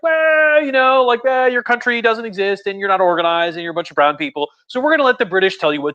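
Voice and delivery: high, mocking patronizing official; sarcastic high voice of a colonial power; condescending League of Nations.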